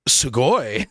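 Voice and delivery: drawn-out, swooping exclamation of awe; imitated amazed onlooker. Japanese passers-by approaching the travelers, imitated awe. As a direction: gush like an impressed passer-by. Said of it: "Japanese people approaching us being like".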